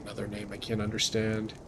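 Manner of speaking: ordinary delivery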